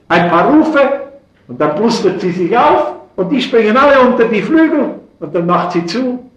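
loud, high impassioned preacher voice; fervent preaching. Acted. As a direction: preach it at full force from the pulpit. Preacher rising to passion.

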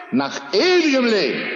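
fervent pulpit call; preached cry. Acted. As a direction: call out ewigem Leben as the climax. Preached climax of the refrain.

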